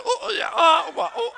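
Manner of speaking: high, loud laughing imitation; mock the garbled sounds through laughter; baffled mimicry. Host teasing co-host about her accent, laughing baffled mimicry. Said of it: "I didn't know what you said".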